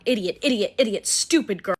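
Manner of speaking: loud scolding of herself; calling herself stupid for getting in his car, loud; self-scolding. Real, not acted. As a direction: berate yourself, loud.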